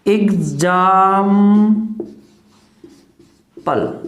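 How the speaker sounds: एग्जांपल stretched very slowly, syllable by syllable; slow demo word. Shown as a demonstration. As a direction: draw out एग्जांपल for the class. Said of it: "वो unstressed रहेगा". (एग्जांपल को बहुत धीरे खींचकर)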